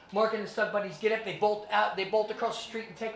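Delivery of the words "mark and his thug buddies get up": normal talking voice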